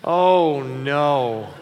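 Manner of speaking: loud, drawn-out oh no; sympathetic oh no. Shown as a demonstration. Dad to teen late for curfew, sympathetic. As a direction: stretch it out, sorry for them. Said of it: "it goes like this".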